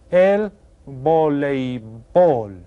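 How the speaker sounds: El voleibol stretched out very slowly; slow model word. Shown as a demonstration. Teacher models El voleibol before asking the class to repeat. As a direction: pronounce El voleibol slowly and clearly. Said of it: "Can you say that?".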